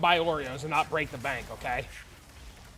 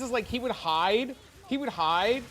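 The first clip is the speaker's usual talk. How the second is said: usual speaking voice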